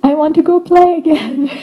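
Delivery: put-on high, eager little child wanting to run off; impatient child. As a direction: pull away like a squirming kid. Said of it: "they're like".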